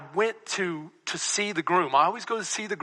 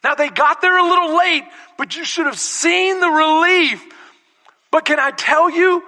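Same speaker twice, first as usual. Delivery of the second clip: high, raised, driving delivery; full-force preaching; 11 semitones higher, 9 dB louder than usual. Real, not acted. Preacher recounts the late caterers' arrival, preaching loudly. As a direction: belt out the testimony to the room.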